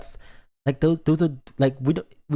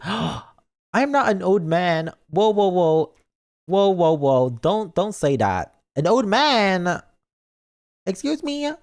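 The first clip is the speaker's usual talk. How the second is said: hammed-up indignant protest, pitched up; mock offended; 5 semitones higher, slower than usual. Streamer to chat that called them an old man, mock offended. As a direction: gasp and protest in drawn-out mock outrage.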